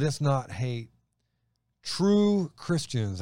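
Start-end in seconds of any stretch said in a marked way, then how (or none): 1.8-3.2 "True" hit high and slow, stretched out; stress on true. Shown as a demonstration. Host stressing "True" to his co-host while making a point about faith. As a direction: lean hard on "True" to make a point. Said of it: "and I emphasize the word true".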